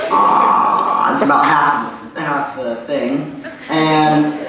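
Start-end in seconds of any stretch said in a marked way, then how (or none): 0.1-1.1 very deep presidential filler sound; Obama. Shown as a demonstration. Comic demonstrating the president's deep voice, Obama impression. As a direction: drop way down into a held hesitation. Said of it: "make a deep voice".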